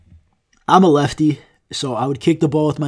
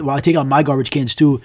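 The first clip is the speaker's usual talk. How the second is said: ordinary talk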